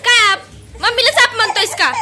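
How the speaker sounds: teasing scold. Real, not acted.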